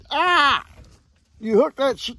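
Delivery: normal talking voice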